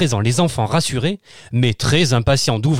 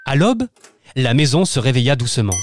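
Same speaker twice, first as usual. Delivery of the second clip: ordinary delivery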